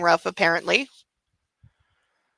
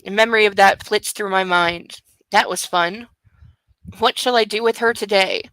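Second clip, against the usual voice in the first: ordinary delivery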